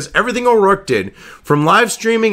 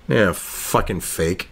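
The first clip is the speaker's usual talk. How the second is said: ordinary talk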